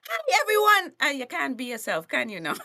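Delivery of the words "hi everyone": fake-cheery corporate greeting. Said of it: "you're like".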